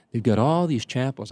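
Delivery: normal talking voice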